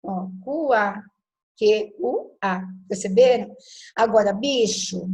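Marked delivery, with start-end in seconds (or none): 0.4-2.6 "cuá" opened out into u and a, then "Q U A" spelt slowly; every letter sounded. Shown as a demonstration. Teacher proving three sounds to students, slow sounding-out. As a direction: sound each part of "cuá", then "Q U A" one by one. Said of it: "eu pronuncio as três".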